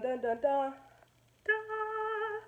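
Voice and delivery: usual speaking voice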